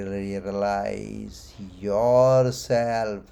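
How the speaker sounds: slow, with "yourself" stretched out and weighted; stress on yourself. Shown as a demonstration. Teacher underlining the goal for students, "yourself" drawn out. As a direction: lean long and hard on "yourself". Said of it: "Underline the word".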